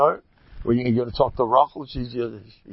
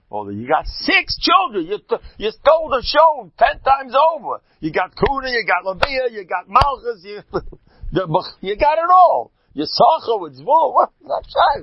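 loud and much higher, building exclaims at Leah; mock indignant rant; 11 semitones higher, 6 dB louder than usual. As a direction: rattle off her blessings in outrage. Preacher scolding Leah in mock address.

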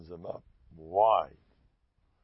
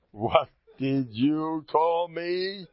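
higher, louder and slow, drawn-out indignation; offended retort, repeated hotter; 6 semitones higher, 4 dB louder, much slower than usual. Retold or imitated. The insulted guest repeats his question more sharply. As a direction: lean in and demand the answer slowly. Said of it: "So the guy says".

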